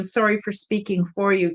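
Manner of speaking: usual speaking voice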